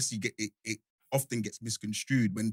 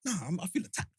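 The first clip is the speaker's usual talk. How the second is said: mock offended; 7 semitones higher, 6 dB quieter than usual. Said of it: "I'm looking at it like".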